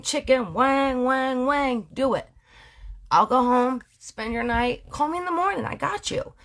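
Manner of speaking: high, easygoing husband voice; lightly voiced husband. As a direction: play the doting husband, lightly. Jeff voiced in story.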